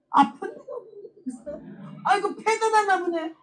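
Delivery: high, slow, laughing wonder; delighted disbelief; amazed joy. Retold or imitated. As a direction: marvel with a laugh. Amazed no pain remains.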